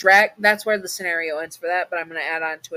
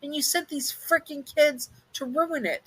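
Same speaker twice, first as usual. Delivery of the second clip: much higher outraged yell; angry shout; 10 semitones higher than usual. A narrator's reading. You shouting at Oogie Boogie over the potion, outraged. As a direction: demand it of her, furious. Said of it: "you shouted at her".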